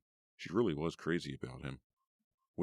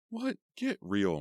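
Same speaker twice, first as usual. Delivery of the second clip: angry retort; 5 semitones higher, 5 dB louder, slower than usual. A narrator's reading. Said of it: "Meg cried angrily".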